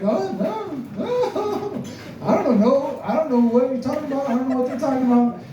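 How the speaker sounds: high, fast panicked denial. Retold or imitated. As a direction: deny everything in a rush, squeaky. Kid denying to dad.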